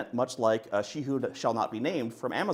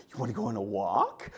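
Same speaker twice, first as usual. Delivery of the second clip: talking to the dog; 7 semitones higher than usual. Retold or imitated. Asking the dog about a walk.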